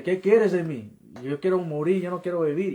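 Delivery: loud, high, desperate cry; retold shout at God. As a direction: yell your despair at God, reliving it. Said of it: "comencé a gritarle a Dios así".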